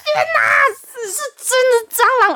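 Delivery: high, slow, horrified realization; shocked discovery. Retold or imitated. Girl spotting the roach by the door, horrified. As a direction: let the shock break out, slowly.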